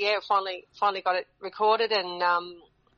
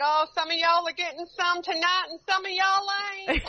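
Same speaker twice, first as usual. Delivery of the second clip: cheeky teasing; 11 semitones higher than usual. Retold or imitated. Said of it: "I said".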